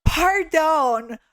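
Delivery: high, slow, strained French question; laughing imitation of a nervous traveler; mock struggling tourist. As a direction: squeeze out the sentence with effort. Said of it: "And he says".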